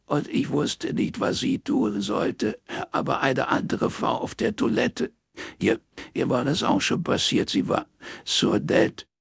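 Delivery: crying hard while talking; sniffling sobs. A narrator's reading. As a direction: gulp and hiccup through the words. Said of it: "hörte ihr zerschniefen, schlucken und hexen".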